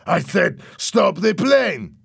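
loud and high, stretched at the end; exasperated acted yell; angry shouted order. A narrator's reading. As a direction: hammer the order home. Louis repeats his shouted order.

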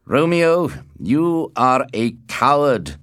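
loud, high accusation; crying out. A narrator's reading. Tybalt challenges Romeo in the plaza, crying out loudly. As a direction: call him out, loud and drawn out. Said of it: "he cried".

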